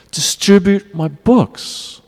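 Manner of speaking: high, slow imitation of a heartfelt appeal; visceral cry. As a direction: draw it out as an imploring cry. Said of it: "It was a visceral cry like anything".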